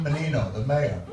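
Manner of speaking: ordinary delivery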